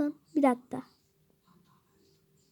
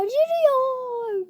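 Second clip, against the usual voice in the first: high drawn-out yürüyor; 8 semitones higher, much slower than usual. Pretend. Mock-narrating the walking creeper.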